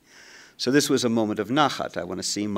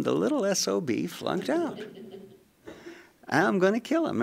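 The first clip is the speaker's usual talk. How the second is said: higher, exasperated threat; mock fury; 10 semitones higher, 4 dB quieter than usual. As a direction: play the furious father, half joking. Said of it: "And I'm going".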